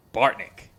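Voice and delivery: "Bartnick" with a loud punch on Bart; emphatic first syllable. Retold or imitated. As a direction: imitate an announcer hitting the first syllable of "Bartnick". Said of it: "Emphasis on the first syllable in that last name".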